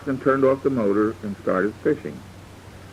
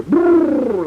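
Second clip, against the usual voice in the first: sudden high shouted boo; loud boo; 13 semitones higher, 7 dB louder than usual. Retold or imitated. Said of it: "like that".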